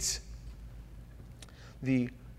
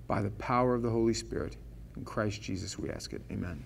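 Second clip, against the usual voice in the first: soft, hushed pastoral prayer; quiet prayer; 5 semitones lower, 5 dB quieter than usual. Acted. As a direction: pray softly over the congregation. Pastor prays before the sermon.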